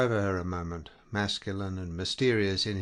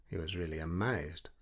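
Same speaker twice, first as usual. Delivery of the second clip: usual speaking voice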